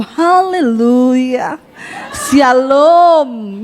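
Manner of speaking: long drawn-out, slow greeting; sing-song church greeting. Acted. Churchgoing couple greeting each other every day, drawn-out and sing-song. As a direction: stretch each syllable like a pious hello.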